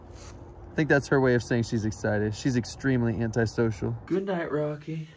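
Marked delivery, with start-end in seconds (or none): none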